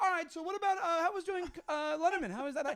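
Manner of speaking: high-pitched, loud fumbling interviewer; awkward host. Retold or imitated. Host moving on after the comic refuses, awkwardly. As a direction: imitate a thrown host scrambling for the next question. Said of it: "and then it was awkward".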